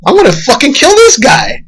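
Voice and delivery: high, strained Joker turning on his idol; Joker snapping. Retold or imitated. Joker deciding to kill the host, high and strained. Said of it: "he's like".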